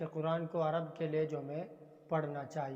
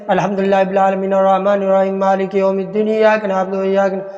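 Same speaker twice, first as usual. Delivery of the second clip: fast, hurried recitation of الحمدللہ رب العالمین with words swallowed; rushed recitation; 17 dB louder than usual. Retold or imitated. Teacher imitating children who rush the recitation, fast and hurried. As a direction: rattle off الحمدللہ رب العالمین quickly without pause. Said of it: "تو وہ کیسے پڑھتے ہیں؟".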